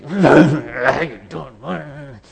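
drawn-out, strained mock hello; mortified greeting. Embarrassed man meets the one he dismissed, drawn-out awkward hello. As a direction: squirm through a stretched, groaning greeting.

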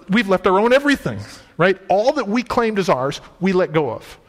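normal talking voice

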